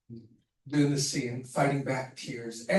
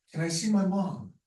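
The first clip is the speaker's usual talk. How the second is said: "Can I see my mom?" raised, a longing plea with the stress on "my"; pleading, near tears; 5 semitones higher than usual. Retold or imitated. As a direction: ask for mom like a grieving son in the scene. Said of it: "the most important thing when I say".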